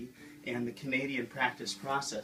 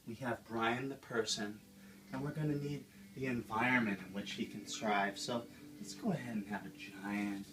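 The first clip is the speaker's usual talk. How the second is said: gentle, soothing painting-show host voice, a Bob Ross send-up; Bob Ross Junior. Acted. Host of a painting show, talking to viewers at home: soothing Bob Ross voice. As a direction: coax the canvas along like a dreamy TV painter.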